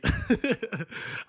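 helpless little laugh at the memory; real laughing. Real, not acted. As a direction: laugh to yourself, thinking back on it. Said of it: "I'm just laughing now".

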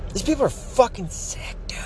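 hushed, breathless whisper of disgust. Real, not acted. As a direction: drop to a whisper, fed up. Disgusted aside after a sigh.

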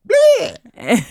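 high squawking bleh; creepy baby noise. Retold or imitated. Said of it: "you're just gonna hear".

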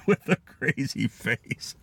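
slow words broken up by laughter; laughing. Real, not acted. Reading out a friend's milkman joke, laughing. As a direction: laugh your way slowly through it.

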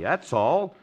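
normal talking voice

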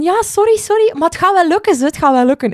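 high, squeaky mock voice of a young girl; apologetic girl. Younger soprano reassuring the anxious singer, squeaky high voice. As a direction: chirp it like a sheepish younger girl. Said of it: "die had zoiets van".

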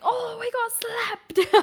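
very high, long-drawn mock caster cry; excited shoutcaster. Co-host shows how excited the shoutcasters get, hammed-up excitement. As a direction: imitate a shoutcaster thrilled by the play, stretching each word. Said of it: "They get really excited".

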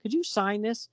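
high-pitched starstruck fan woman; hammed-up adoring admirer; gushing fan. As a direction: beg for the autograph breathlessly. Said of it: "she says".

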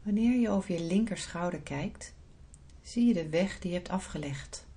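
soft, unhurried meditation voice; guided meditation. Acted. Leads a guided visualisation.

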